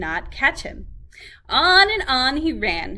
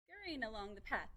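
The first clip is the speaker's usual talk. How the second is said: plain reading voice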